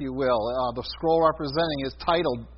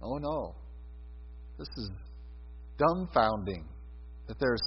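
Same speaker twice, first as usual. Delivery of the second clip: awed hush; 7 semitones lower, 10 dB quieter than usual. Real, not acted. Preacher awed by heaven's silence.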